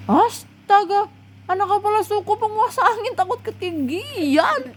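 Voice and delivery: high, stunned disbelief; shocked exclamation. Acted. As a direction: gasp in disbelief at the chief's son. Friends shocked at the confession.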